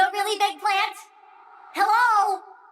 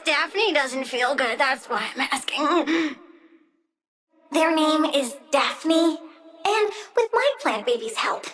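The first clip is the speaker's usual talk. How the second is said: usual speaking voice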